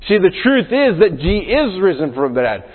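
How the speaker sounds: ordinary talk, nothing marked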